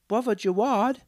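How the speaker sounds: put-on high, loud child's call; excited child shouting his name. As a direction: tug at his sleeve with your voice. Children calling out to him.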